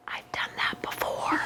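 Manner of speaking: soft confiding whisper; whispered aside. Real, not acted. Pastor confides to the kids in a whisper.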